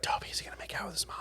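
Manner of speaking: scandalized movie-theatre whisper; whispered aside. Retold or imitated. Watching a movie, asking friend Toby, hushed whisper.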